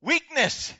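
high, loud cry of weakness; loud opening cry. Acted. Preacher calling the word out to the church, loud. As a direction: call the word out.

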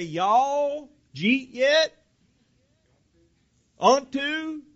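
mock country dialect, drawn out and high; folksy dialect. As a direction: slur the words together in an exaggerated country dialect. Mocks Galilean fishermen's folksy talk.

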